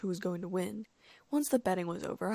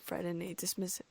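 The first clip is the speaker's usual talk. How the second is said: usual speaking voice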